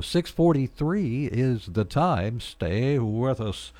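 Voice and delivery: slow, booming radio announcer; big announcer voice. Shown as a demonstration. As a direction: stretch it out like a big-time announcer. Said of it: "I'm putting on the big announcer voice".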